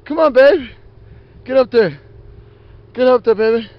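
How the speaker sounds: high, loud pet-coaxing voice; high cheerful call to a pet; urging the dog on. Real, not acted.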